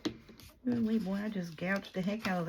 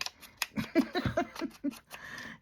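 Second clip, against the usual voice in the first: airy high laugh at herself; a short amused laugh; breathy laugh; 11 semitones higher than usual. Real, not acted. Laughs at her pink again.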